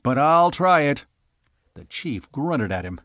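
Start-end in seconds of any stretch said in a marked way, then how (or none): 0.0-1.0 loud, high bitter threat; bitter defiance, louder and higher. A narrator's reading. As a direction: threaten him bitterly, voice raised. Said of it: "he said bitterly".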